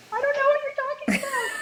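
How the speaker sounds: very high-pitched mock confusion; baffled squeal. Yoga teacher confused by the mate's boat talk, high squeal. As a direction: squeak it out, completely lost. Retold mix-up over the boat.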